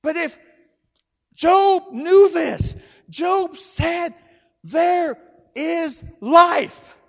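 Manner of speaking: high, loud, slow proclamation; full-force preaching. Real, not acted. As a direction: proclaim it slowly to the whole congregation.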